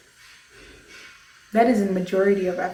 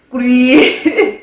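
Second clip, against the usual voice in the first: amused laugh; 3 semitones higher, 9 dB louder than usual. Real, not acted. Laughs at her own point.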